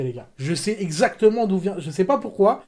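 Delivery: ordinary delivery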